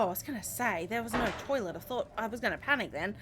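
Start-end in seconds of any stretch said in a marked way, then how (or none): none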